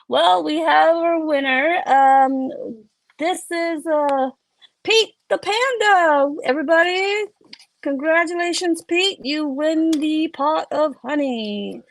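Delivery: high, loud announcer proclaiming a winner; contest host. Acted. Host to the crowd after the climb, showy winner announcement. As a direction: announce it big, stretching out the winner's name. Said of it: "and then the host goes".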